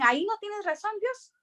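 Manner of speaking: ahí no tienes razón said high and wounded; indignant protest. Retold or imitated. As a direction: complain up high like a stubborn child. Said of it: "Y yo decía".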